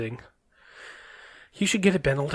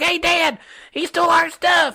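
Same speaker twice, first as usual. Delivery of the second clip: put-on kid voice; 12 semitones higher, slower than usual. Kids calling out to dad.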